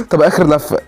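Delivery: ordinary talk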